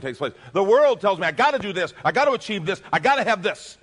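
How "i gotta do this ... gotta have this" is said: louder, higher, pushy demands; the world's demands. Retold or imitated. Pastor voicing the world pressuring us, loud and pushy. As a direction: rattle off the world's orders with mock urgency.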